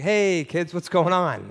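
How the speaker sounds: breezy casual dad. Retold or imitated. Father walking in on giggling kids.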